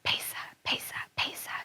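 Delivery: unvoiced hushed whisper. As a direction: whisper it like a secret plan. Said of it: "And she whispered".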